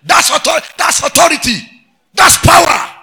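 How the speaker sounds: high, very loud exclamations; full-force preaching. Real, not acted. Preacher acclaiming the caretaker's authority, in a high shout. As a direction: shout it triumphantly from the pulpit.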